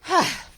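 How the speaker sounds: loud weary sigh at the lost glasses; heavy sigh, as if worn out from searching; exasperated sigh. Acted. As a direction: let out a big fed-up sigh.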